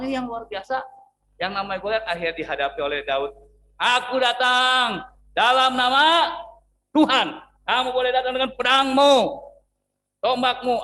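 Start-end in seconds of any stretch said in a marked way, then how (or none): 3.8-10.8 bold declaration. Acted. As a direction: proclaim it to the giant without fear. David declaring against Goliath.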